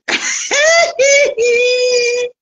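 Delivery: high, drawn-out cackle; gloating laugh. Real, not acted. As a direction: cackle high and long at a rival. Gloats over rival being identified. (rire moqueur, aigu et prolongé)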